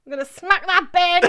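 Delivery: laughing. Shown as a demonstration. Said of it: "that's your child".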